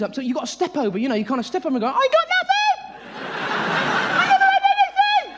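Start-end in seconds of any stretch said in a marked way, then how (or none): 1.9-5.3 very high, squealed protest of innocence; guilty protest. Acted. Guilty shopper protesting at tills.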